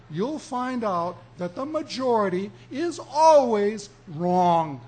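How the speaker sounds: preacher driving home the warning, loud; emphatic loud warning. Real, not acted. Preacher warning congregation the majority errs, loud and emphatic. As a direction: swell up and hit "always wrong" hard.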